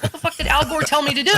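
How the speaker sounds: high, fast baffled outburst; mock exasperation. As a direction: demand an answer in comic frustration. Acted exasperation at missing advice.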